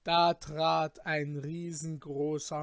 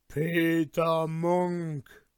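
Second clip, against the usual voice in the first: menacing giant voice; 5 dB louder, much slower than usual. A narrator's reading. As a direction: drag the name out threateningly. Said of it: "stechenden, furchtbaren Blick". (drohend, langsam gedehnt)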